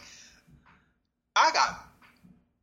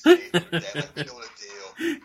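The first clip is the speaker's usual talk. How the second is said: laughing; 9 semitones higher, 6 dB louder, much slower than usual. Retold or imitated. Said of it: "they talk to the fan like".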